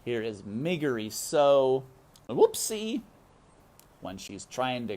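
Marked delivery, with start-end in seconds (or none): none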